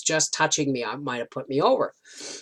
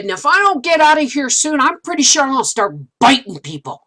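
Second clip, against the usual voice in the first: playful threat; 8 semitones higher, 5 dB louder than usual. Retold or imitated. Joking to husband in aisle.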